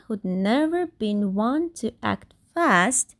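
usual speaking voice

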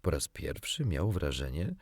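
plain reading voice

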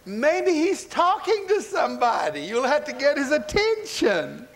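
high mocking call; acted high-pitched taunt. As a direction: heckle them in a high voice. Elijah mocks the yelling prophets.

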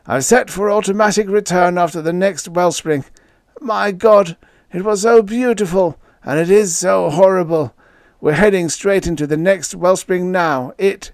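high, strained voice of horror; desperate, struggling. A narrator's reading. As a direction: force out a last panicked message. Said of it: "He fought to get the words out".

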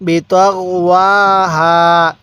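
বিতগওয়াহা read slowly with each syllable clear; slow recitation demo. Shown as a demonstration. Teacher slowly models বিতগওয়াহা for students. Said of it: "কীভাবে পড়বেন?".

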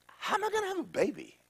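bewildered Mary. Acted. Mary asking the angel, high and bewildered. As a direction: squeak the question out, baffled. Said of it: "She go".

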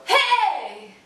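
high, loud, strained shriek; shrieking hey. Shown as a demonstration. Coach showing the shrieked wrong hey. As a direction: push it until the voice crashes. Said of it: "the latter was just like shrieking".

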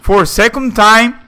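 loud, high exasperated yell; frustrated shout. Real, not acted. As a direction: yell it, fed up again. Killed again after special move.

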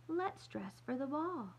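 put-on girlish doll play voice. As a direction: make the doll speak, lifted and slow. Girl playing with paper dolls.

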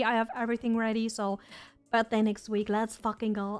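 usual speaking voice

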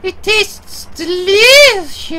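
voiced high and slow, much above his own pitch; high slow character voice. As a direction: draw it out in a high voice, savouring it. Voicing a game character.

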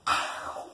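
sudden yelp of pain; short sharp ow. Real, not acted. Ball hits him.

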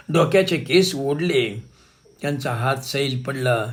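usual speaking voice

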